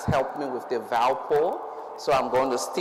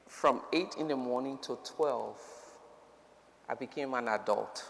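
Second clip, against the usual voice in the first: ordinary talk, nothing marked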